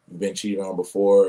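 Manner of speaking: ordinary talk, nothing marked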